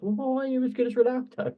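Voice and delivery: high, fast mocking jeer; mocking critic voice. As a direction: sneer it like a pushy pundit. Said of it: "he sort of got hounded".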